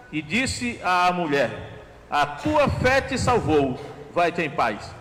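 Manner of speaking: slow Bible reading; scripture reading. Acted. Preacher reading Jesus forgiving the woman, slow and solemn. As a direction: read Jesus' words gently and slowly. Reads Luke 7:48-50.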